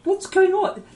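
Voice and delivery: sudden sharp high yelp; startled by the screen; surprised. Real, not acted. Streamer startled by something in his game, surprised.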